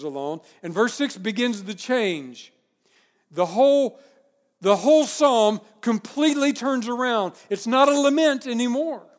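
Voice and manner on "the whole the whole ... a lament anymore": louder, emphatic preaching; rising intensity. Real, not acted. Preacher to congregation, loud emphatic build. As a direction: drive home the turn in the psalm.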